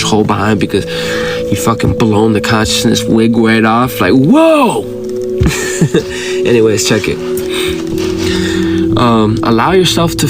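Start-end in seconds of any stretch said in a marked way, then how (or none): 4.0-4.8 excited exclamation. Real, not acted. Exclaims about blowing the wig off. 4.9-6.4 airy, high giggle; breathy laugh. Real, not acted. Laughs after exclamation.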